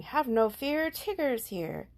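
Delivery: high, loud, grand announcement; proud, boisterous tiger voice; Tigger, profoundly. Acted. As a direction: declare it like a bouncy hero. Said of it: "he said profoundly".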